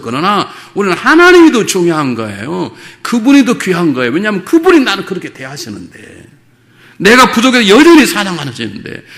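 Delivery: loud, high, driving sermon voice; preaching at full force. Acted. Pastor urging the congregation to love God, loud and high. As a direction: press the point home to the whole church.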